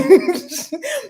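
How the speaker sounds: short bright burst of laughter; real laugh at the joke; amused laugh. Real, not acted. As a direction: laugh at yourself. Laughs about fan preferring Cody.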